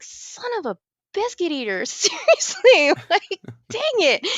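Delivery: high, exasperated mock cursing, slowing on seriously; mock swearing. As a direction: vent it like a cartoon curse. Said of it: "I was like".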